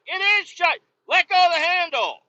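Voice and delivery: high, loud mock scolding; exasperated yelling. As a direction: argue back in a high, indignant yell. Retold quarrel with door slammer.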